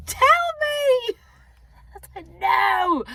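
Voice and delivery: high, loud disbelief, laughing; incredulous. Real, not acted. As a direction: cry out in disbelief, then laugh. Incredulous at husband's answer.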